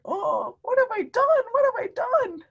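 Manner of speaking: high, frantic voice for George; imitating George's panic. Reader voicing George dismayed over the ink mess. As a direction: squeal it like a panicked little monkey. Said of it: "He's like".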